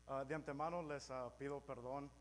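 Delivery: Spanish spoken with an American accent; English speaker's careful accented Spanish. Real, not acted. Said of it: "mi español me limita".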